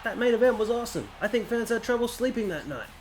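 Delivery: excited, fast-starting Southern announcer impression; Southern J R accent. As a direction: call the show in a put-on Southern accent, pitched well up. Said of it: "that's my best Southern J R accent".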